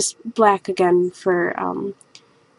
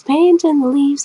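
mock excitement; 7 semitones higher than usual. Shown as a demonstration. Painter hyping up the leaf painting to viewers, mock excited. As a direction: paint in the leaves sung out higher and slow. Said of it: "How exciting".

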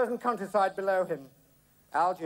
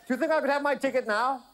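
higher-pitched raised voice; shouted request; 6 semitones higher, 3 dB louder than usual. A narrator's reading. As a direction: call the question across the cockpit. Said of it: "he shouted".

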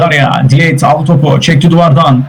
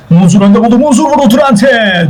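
excited shot call; 8 semitones higher than usual. Real, not acted. Commentator calling Muzur's shot at Durante, excited. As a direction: pitch leaping up on the shot.

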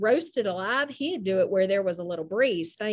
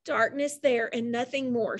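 usual speaking voice

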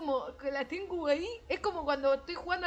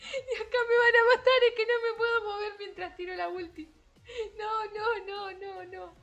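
panicked laughing whine; 8 semitones higher, 4 dB louder than usual. Real, not acted. Panicking as she is about to die.

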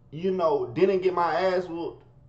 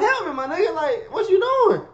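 desperate plea; 7 dB louder than usual. Retold or imitated. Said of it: "I'm in this hoe, like".

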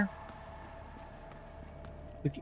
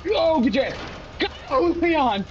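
frantic urging; 13 semitones higher, slower than usual. Real, not acted. Running from danger, she yells at her character to go. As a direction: cheer your character on desperately.

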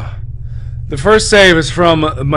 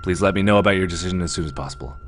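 document reading; 4 semitones lower than usual. Acted. Reads an in-game document aloud.

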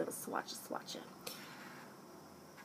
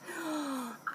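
soft, drawn gasp of excitement; excited gasp; 7 semitones higher, 7 dB quieter than usual. Real, not acted. Vlogger thrilled to show the collection, excited gasp. Gasps while showing excitement.

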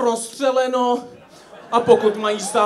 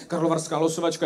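ordinary delivery

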